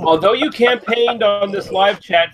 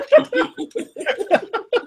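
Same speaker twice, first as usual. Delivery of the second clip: high laugh. Real, not acted. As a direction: burst of high-pitched laughter. Laughing at Lee joke.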